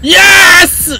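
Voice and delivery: triumphant yell of yes; shout. Real, not acted. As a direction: shout it out in victory. Cheering after a win.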